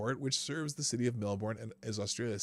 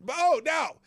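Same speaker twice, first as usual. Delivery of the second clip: sudden excitement; 8 dB louder than usual. Real, not acted.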